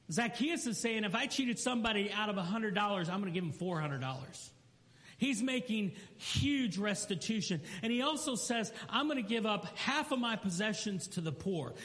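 high, ringing sermon delivery; preaching. Acted. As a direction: build it up from the pulpit. Fervent preaching passage.

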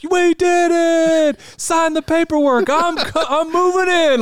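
put-on high, loud, slow cheering kid; excited kid. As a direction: cheer like a thrilled child. Kid celebrating a win.

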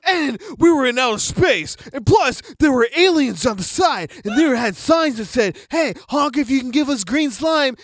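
loud, high teasing story; loud, high mock telling of a silly dream; mock dramatic shout. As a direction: ham it up like a wild tall tale. Mocking teasing story loudly.

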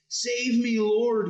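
high, loud cry for rescue; shouted plea. A narrator's reading. Sinking Peter to Jesus, acted shout for help. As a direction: shout it out urgently, well above the narration. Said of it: "he shouted".

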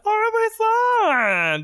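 very high, slow cartoon caterpillar; fat caterpillar voice. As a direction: stretch it out like a chubby cartoon bug. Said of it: "I did the, uh, fat caterpillar's voice".

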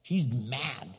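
ordinary delivery

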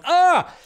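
high, loud hammed-up "Ah!"; a fake hype yelp at a trailer; mock excited yelp. Host parodying trailer reactions, high loud mock yelp. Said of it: "watching the trailer going".

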